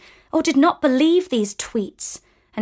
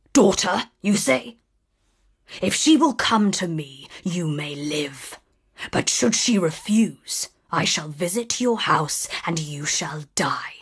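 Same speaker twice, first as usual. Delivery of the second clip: low whispered monster threat; menacing beast; 5 semitones lower, 4 dB quieter than usual. Acted. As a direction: hiss the threat softly.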